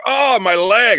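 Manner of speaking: high, loud hammed-up cry of pain; corny fake injury. Groom faking a leg injury to kneel, hammed-up groan. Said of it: "I pulled the corniest move ever".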